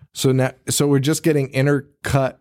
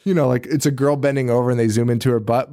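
ordinary talk, nothing marked

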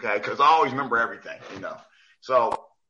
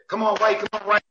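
pitched-up sideline rooting; little cheerleader; 8 semitones higher than usual. Retold or imitated. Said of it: "like a little cheerleader".

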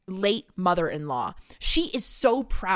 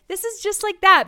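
mock sweet; 10 semitones higher, 9 dB louder than usual. Mock sweet reply to aunt.

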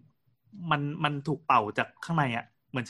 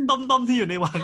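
high mock hint; 10 semitones higher, 5 dB louder than usual. Repeating the quiz hint.

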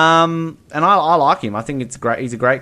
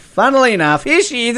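teasing reveal; 10 semitones higher, 4 dB louder than usual. Acted. Playful reveal of [name] cameo.

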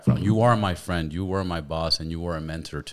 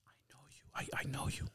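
hushed, eerie whisper; retold creepy whisper; 15 dB quieter than usual. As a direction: whisper it like a spooky stranger. Creepy stranger knows his name.

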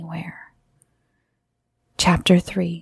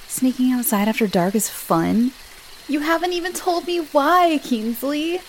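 higher, exasperated protest without real volume; mild shout; 7 semitones higher than usual. A narrator's reading. Boy questions Kingsley about sneaking out, mildly shouted. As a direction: raise the pitch in a mild mock shout. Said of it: "Levi shouted".

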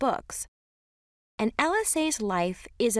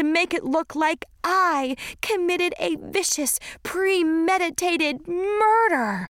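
panicked; 7 semitones higher, 3 dB louder than usual. Pretend. Panicked imagining of murder charge.

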